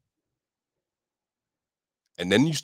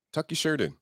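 mock strict adult; 7 semitones higher, 4 dB quieter than usual. Voicing high school dress rules.

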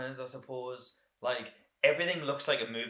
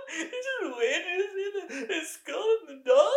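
higher, laughing through the words; nervous laughing. Retold or imitated. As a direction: giggle it out anxiously. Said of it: "I laugh nervously".

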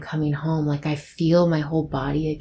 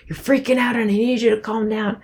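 bossy calming; 5 semitones higher than usual. Retold or imitated. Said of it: "it can be like somebody saying".

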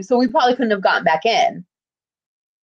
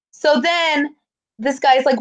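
ordinary talk

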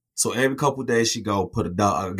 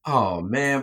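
ordinary talk

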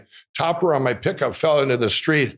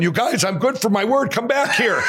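higher, laughing call after the two guys; retold calling out; 8 semitones higher than usual. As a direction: shout them back, half laughing. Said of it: "I really yelled at him".

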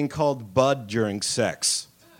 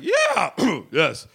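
nervous yes; 9 semitones higher than usual. Retold or imitated. Nervous eager agreement.

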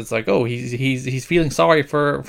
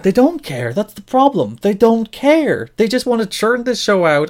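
higher-pitched, fed-up complaint; indignant, pitched-up griping; exasperated rant; 8 semitones higher than usual. Real, not acted. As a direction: vent in real frustration, hitting the point.

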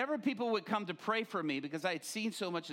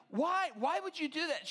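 shocked question; 10 semitones higher than usual. Retold or imitated. Husband questioning wife's prayer plan, high alarmed. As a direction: demand it in alarm. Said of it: "and I'm like".

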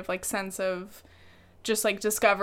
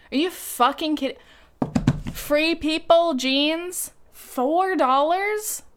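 stunned disbelief; 5 semitones higher, 4 dB louder, slower than usual. Real, not acted. Stunned by a cheap price.

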